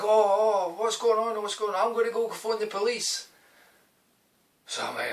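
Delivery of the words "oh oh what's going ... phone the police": high-pitched imitation of a panicking woman; mock alarm. Flower shop woman off to phone the police, mock panic. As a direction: mimic her flustered alarm, pitched way up.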